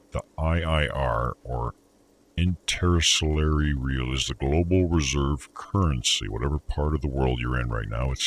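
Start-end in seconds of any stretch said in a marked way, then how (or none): none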